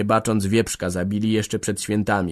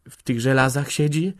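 higher-pitched anxious question; mildly frightened, raised voice; fearful; 5 semitones higher than usual. A narrator's reading. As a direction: force out a worried question. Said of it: "wykrztusiła strachliwie".

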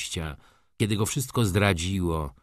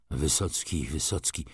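low, softened echo of a name; dazed repeating; 5 semitones lower, 5 dB quieter than usual. A narrator's reading. Wokulski repeats the railwayman's name, dazed. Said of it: "powtórzył Wokulski".

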